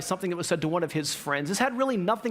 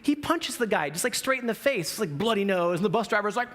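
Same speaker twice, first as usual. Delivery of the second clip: ordinary delivery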